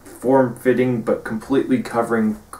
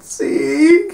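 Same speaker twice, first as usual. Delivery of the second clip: much higher, louder yell of his name; shrill shouted name; 6 dB louder than usual. Retold or imitated. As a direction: cry out his name in alarm. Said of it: "Kisuku's like".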